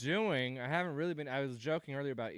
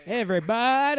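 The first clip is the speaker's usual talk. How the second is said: loud call to the room, higher; loud higher greeting to the crowd; 7 semitones higher, 12 dB louder than usual. Real, not acted. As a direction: call everybody in. Host calling out to audience.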